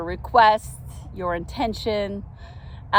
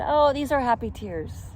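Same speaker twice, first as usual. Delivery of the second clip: a bit higher, lightly reassuring; a touch of teary cheer; 6 semitones higher than usual. Retold or imitated. Narrator reassuring the park lady, lightly teary cheer. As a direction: soften it, tearful but happy. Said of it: "And I said".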